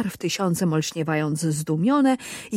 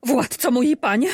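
higher, louder scared cry; frightened shriek; 6 semitones higher, 4 dB louder than usual. A narrator's reading. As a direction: scream it, scared of your own words. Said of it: "wrzasnęła przerażona własnymi słowami Maruda". (przerażony wrzask)

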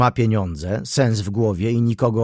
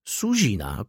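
ordinary delivery, nothing marked

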